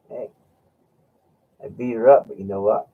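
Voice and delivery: usual speaking voice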